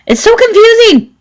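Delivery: high squeal of exasperation; exasperated squeal. Real, not acted. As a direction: squeal it out, overwhelmed. Mock exasperated exclamation.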